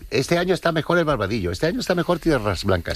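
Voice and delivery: imitated debaters. Guest mimics locals arguing over wine brands, imitated debaters. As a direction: voice both sides of a yearly squabble. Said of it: "siempre está la polémica".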